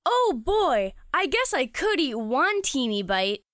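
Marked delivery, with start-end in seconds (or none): none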